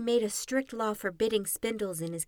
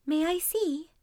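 put-on high, curious young princess voice; cartoon princess; 7 semitones higher, 4 dB louder than usual. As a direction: ask sweetly like a storybook princess. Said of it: "the princess asked".

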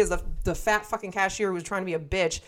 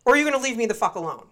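fast, high, louder threat; confrontational; 5 semitones higher, 3 dB louder, much faster than usual. Retold or imitated. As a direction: fire it off like a challenge to fight. Retold confrontation with cashier.

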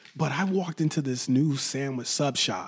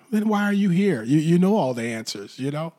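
mock retort; 5 semitones higher, 6 dB louder than usual. Retort to imagined know-it-all listener.

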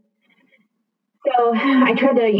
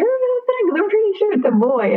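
much higher, incredulous protest; stunned insistence; 13 semitones higher than usual. Retold or imitated. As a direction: refuse to believe it, pitch way up. Insists it must be a boy.

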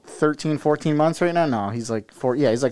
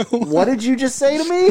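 higher, mock indignant question; mock offended; 6 semitones higher than usual. Reacting to imagined posh baby, mock offended. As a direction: play it offended.

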